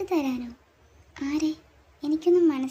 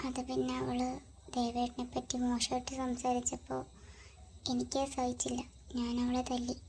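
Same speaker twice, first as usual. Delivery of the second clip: much softer, hushed voice; 11 dB quieter than usual. Acted. A girl wondering about a late call from Ram, hushed and subdued.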